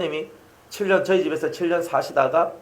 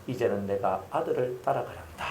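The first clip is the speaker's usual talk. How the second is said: softer, lower weak voice; frail father's vow; 4 semitones lower, 8 dB quieter than usual. Retold or imitated. Dying father telling daughter he will follow his son, softly. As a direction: say it faintly like an old sick man. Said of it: "했는 말이 뭐냐면".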